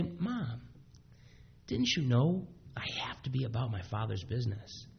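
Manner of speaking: soft whispered Mom and question; hushed loving boy; gentle whisper. Retold or imitated. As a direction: whisper it tenderly to his mother. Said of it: "such a gentle, loving way".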